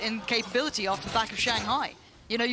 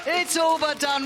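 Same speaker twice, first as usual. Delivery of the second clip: high, loud, quicker call; shouted repeat; 6 semitones higher, 5 dB louder, slower than usual. Real, not acted. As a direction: shout it again, clipped. Same words repeated.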